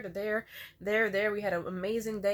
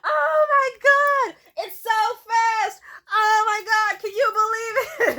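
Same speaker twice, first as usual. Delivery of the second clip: hammed-up shrieks, much higher and louder, stretched slow; fake-terrified yells on a slow kiddie ride, playing it up; mock screaming; 12 dB louder, slower than usual. Couple on a slow merry-go-round, mock screaming in fake terror. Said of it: "simultaneously screaming".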